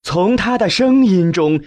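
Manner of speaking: usual speaking voice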